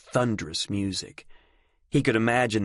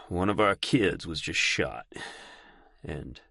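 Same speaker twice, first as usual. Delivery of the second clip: sigh, then fade to silence; words drain away in grief; grieving; 3 semitones lower, 4 dB quieter than usual. Acted.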